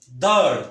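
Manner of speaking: दाढ़ loud with a heavy breathy ढ़; heavy sound. Shown as a demonstration. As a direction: punch दाढ़ out heavily. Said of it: "original जो ख़ास आवाज़ है". (भारी आवाज़ में दाढ़)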